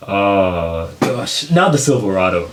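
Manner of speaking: normal talking voice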